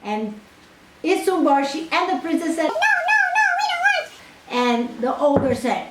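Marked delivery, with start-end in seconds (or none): 2.8-4.1 very high, frightened no no no; high refusing voices. Acted. The pair refuse the ogre, very high. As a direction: squeal a refusal.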